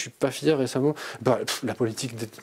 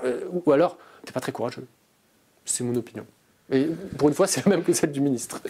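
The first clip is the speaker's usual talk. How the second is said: ordinary talk, nothing marked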